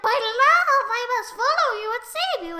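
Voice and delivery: high, loud melodramatic lover; hammed-up devotion. Kurt jumps in after his love, hammed-up devotion. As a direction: proclaim it high with mock passion.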